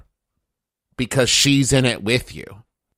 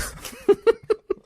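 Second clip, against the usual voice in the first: high giggling laugh at his own joke; burst of laughter. Real, not acted. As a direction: crack up laughing. Player cracks up at own joke.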